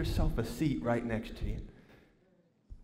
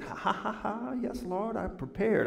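soft, higher gloating chuckle into words; mock gloating laugh; 4 semitones higher, 5 dB quieter than usual. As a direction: laugh smugly as if prepared. Gloats over being prepared for baby.

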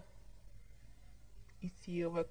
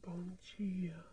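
soft whispered good morning; 10 dB quieter than usual. Acted. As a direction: hush it gently. Whispered throughout scene. (sussurro suave)

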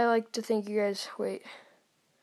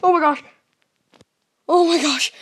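loud, high scream of shock; panicked, high-pitched shout; real fright; 6 semitones higher, 15 dB louder than usual. Real, not acted. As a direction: scream it as if something jumped out. Jump scare in horror game.

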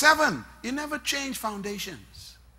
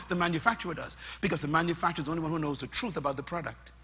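ordinary delivery

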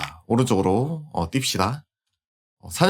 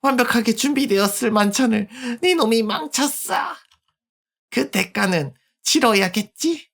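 put-on sinister girl; 11 semitones higher than usual. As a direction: promise punishment calmly. Girl predator threatens.

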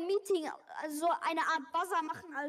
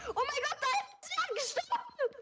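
shocked; 9 semitones higher than usual. Real, not acted. Finds a dead body in game.

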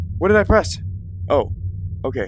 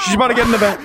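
alarmed; 9 semitones higher, 4 dB louder, much faster than usual. Real, not acted. Repeats warning.

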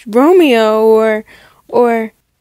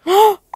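sharp gasp; 8 semitones higher than usual. Acted. Thrilled at the brunch offer.

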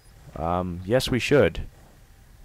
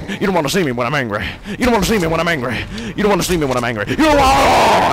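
fast, loud and high mock rage; hammed-up angry threat; 10 semitones higher, 7 dB louder, faster than usual. Gamer ranting at the giant in mock rage. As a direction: rant the threat over and over. Hulk-style repeated threat.

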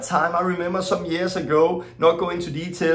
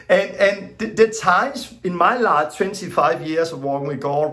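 ordinary delivery

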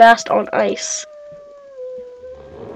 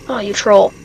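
ordinary talk

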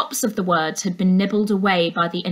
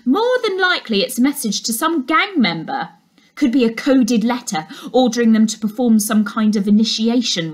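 know-it-all dog walker; 3 semitones higher, 4 dB louder than usual. Acted. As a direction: lecture the surfer like an expert on gangs. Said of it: "said the dog walker".

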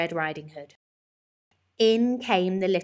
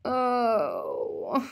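weary groan; 4 semitones higher than usual. A narrator's reading. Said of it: "Missus Large groaned".

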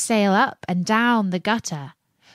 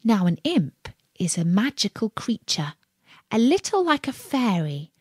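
usual speaking voice

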